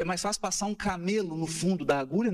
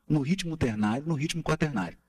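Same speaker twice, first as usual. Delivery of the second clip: ordinary talk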